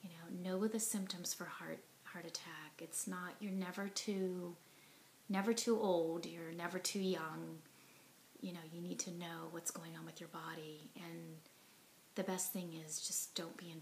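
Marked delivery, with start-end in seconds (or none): none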